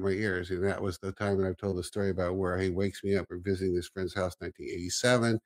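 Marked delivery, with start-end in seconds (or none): none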